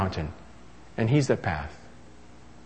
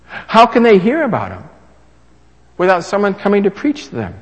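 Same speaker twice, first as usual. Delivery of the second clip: louder, higher pulpit question; emphatic preaching; 5 semitones higher, 6 dB louder than usual. Acted. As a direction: press the question on the crowd. Preaching at full force.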